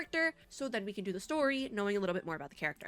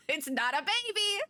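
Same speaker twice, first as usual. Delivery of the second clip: higher, amused protest; mock exasperation; 8 semitones higher than usual. Real, not acted. Streamer objecting to the baby in the story, amused exasperation.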